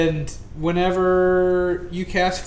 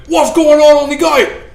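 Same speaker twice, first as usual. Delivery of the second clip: orc voice; 11 semitones higher, 12 dB louder than usual. Acted. GM voices orcs at gate.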